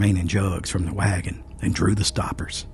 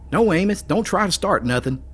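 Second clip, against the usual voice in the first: much higher friendly warning voice; cautioning friend; 11 semitones higher than usual. A narrator's reading. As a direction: gently warn him off. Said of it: "the friendly Phil cautioned".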